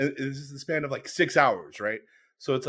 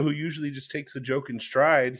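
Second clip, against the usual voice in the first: ordinary talk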